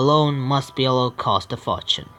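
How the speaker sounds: normal talking voice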